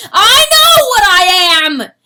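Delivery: very loud high wail. Acted. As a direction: wail out in self-loathing. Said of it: "he wailed".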